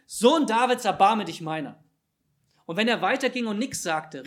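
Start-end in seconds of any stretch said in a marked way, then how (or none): 0.1-1.8 loud, high cry for mercy; calling out. Acted. Blind beggars at the roadside call to Jesus, loud pleading cry. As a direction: call out hard, then let the pitch fall. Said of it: "riefen sie".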